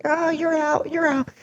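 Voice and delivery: high-pitched mock little kid voice; squeaky playground gloat, imitating the kid; dad imitating the kid who hit him, in a high kid voice; kid taunting. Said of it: "And he's like".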